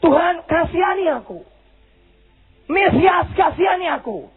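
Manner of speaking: a hint of a desperate mother crying out; mother's plea. Preacher voicing the mother begging Jesus, pleading cry. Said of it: "Seperti ibu ini berkata".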